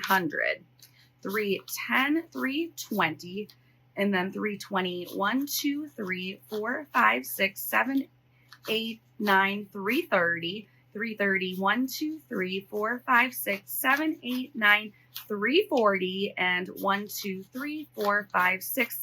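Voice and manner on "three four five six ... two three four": ordinary delivery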